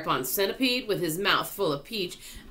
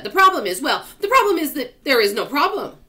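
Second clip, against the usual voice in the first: loud; 8 semitones higher, 8 dB louder, faster than usual. A narrator's reading. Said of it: "the earthworm said".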